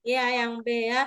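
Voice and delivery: ordinary talk, nothing marked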